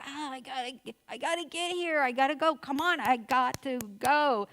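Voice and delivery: hammed-up frantic hurry, pitch jumping up; anxious rushing. Harried mom rushing to get out the door, acted anxiety. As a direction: act out a harried mom panicking to leave. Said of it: "I'm feeling the anxiety".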